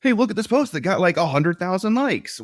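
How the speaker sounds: high, excited bragging voice; mock bragging. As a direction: show off like a giddy poster. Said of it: "I'm not saying".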